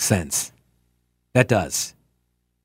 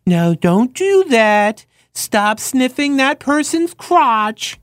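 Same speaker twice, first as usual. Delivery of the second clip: high, slow dog-owner voice; baby-talk scolding; 8 semitones higher, much slower than usual. Shown as a demonstration. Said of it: "they go off of the tone".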